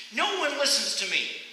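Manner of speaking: high, loud complaint; frustrated cry. Retold or imitated. Poem's runner crying out in frustration. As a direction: cry out that nobody listens. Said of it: "I cried".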